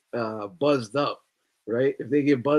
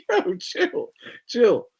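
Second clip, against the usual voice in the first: mock chill; 9 semitones higher, much slower than usual. Telling nosy coworkers off.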